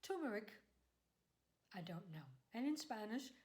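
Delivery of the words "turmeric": turmeric said as "TOO-mer-ic", first syllable high; variant pronunciation. Shown as a demonstration. Host offers viewers a third way of saying turmeric, variant pronunciation. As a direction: hit a long "too" at the start of turmeric, then fall. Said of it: "I've heard people say".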